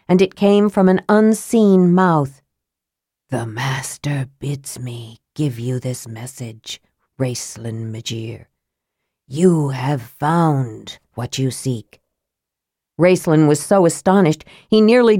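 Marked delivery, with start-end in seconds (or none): 3.3-8.5 low, slow and soft, a dead voice from nowhere; dry, soft wraith voice. A narrator's reading. Wraith delivers the Master's message to Raistlin, low and soft. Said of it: "The voice was dry as bone and soft as ash". 9.3-12.0 low, slow wraith voice on "You have found what you seek."; dry, soft wraith voice. A narrator's reading. Wraith tells Raistlin his search is over, low and slow. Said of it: "The voice was dry as bone and soft as ash".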